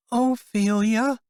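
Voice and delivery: put-on high, slow small girl's voice; a shy little girl answering; little girl giving her name; soft trembling girl. A narrator's reading. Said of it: "the girl said softly, with a little tremble in her voice".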